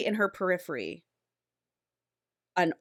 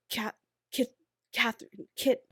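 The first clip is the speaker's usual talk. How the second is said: soft, halting false starts on a name; lightly imitated malfunctioning voice; glitching dying robot; 5 semitones higher, 5 dB quieter than usual. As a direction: stutter softly like a failing machine. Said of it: "like, glitching".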